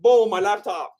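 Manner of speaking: ordinary talk, nothing marked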